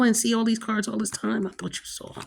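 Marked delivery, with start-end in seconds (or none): 1.5-2.1 soft whispered aside, under the breath; whispered afterthought to the viewers. Real, not acted. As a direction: mutter it to yourself, barely voiced.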